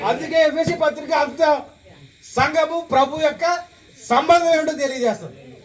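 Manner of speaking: high-pitched insistent sermon delivery; preaching. Real, not acted. Preacher teaching the church about its head, high insistent preaching. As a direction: drive each question and answer home, pitched high.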